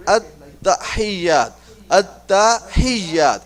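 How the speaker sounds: "at-tahiyyat" slowly split as at-ta, hiy-yat; broken into syllables. Shown as a demonstration. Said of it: "I gonna break it like".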